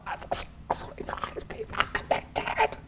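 breathy whisper, hushed and intimate; mock whisper. Teasing his date with a hushed, mock-soulful whisper. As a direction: whisper it like a secret insight. Pretends a deep connection.

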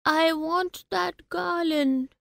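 put-on soft, high, slow child's murmur. A narrator's reading. Child wants a flower garland from parents, high soft murmur. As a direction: murmur it wistfully, small and high. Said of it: "he murmured".

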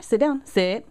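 loud firm commands to a dog. Real, not acted. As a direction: bark out sit like a trainer.

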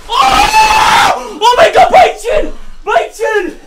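high, loud joyful yell; excited shout. Real, not acted. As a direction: scream it out in triumph. Celebrating a nutmeg.

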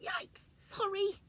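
high, slow startled apology; startled bear. Acted. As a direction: jump back sheepishly. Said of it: "said Alan".

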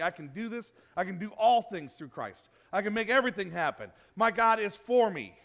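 rally yourself like a preacher; preaching to yourself. Shown as a demonstration. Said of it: "You need to step out and go".

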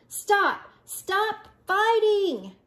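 high, drawn-out plea to stop; calling out. A narrator's reading. Pipsqueak calls to stop the fight.

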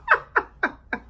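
high burst of laughter. Real, not acted. Vlogger laughing at her New York slang. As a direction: cracks up at her own joke.

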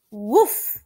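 a gruff little bark; puppy woof. Acted. Voicing the puppy Biscuit.

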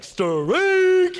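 long, high, loud bellow; umpire's strike call. Acted. Umpire behind the plate calling strikes, drawn-out shout. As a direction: belt it out, stretching the vowel. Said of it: "like, making crazy noises".

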